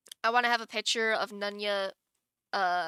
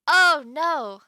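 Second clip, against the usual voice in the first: sudden much louder cry of dismay; real oh no; 5 semitones higher, 12 dB louder than usual. Real, not acted. Streamer spotting a badly exported emote, loud dismayed oh no. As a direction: blurt it as the mistake shows up.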